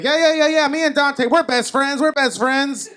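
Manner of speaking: high, loud mock gushing; gushing girlfriend. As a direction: imitate an over-eager girlfriend piping up. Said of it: "my girlfriend starts copying".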